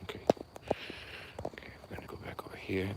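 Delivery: fast hushed whisper aside. Real, not acted. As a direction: whisper it fast under the breath.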